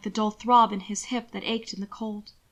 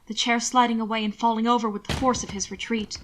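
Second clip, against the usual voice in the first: usual speaking voice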